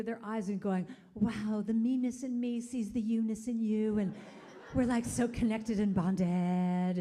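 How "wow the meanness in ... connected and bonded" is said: hammed-up gushing spiritual voice; mock woo-woo. Mocking woo-woo people gazing into eyes, gushing. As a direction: parody a dreamy spiritual type, stretching the end. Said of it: "that woo-woo kind of looking deeply".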